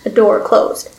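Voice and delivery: plain reading voice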